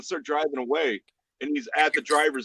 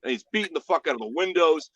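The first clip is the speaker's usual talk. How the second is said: ordinary talk, nothing marked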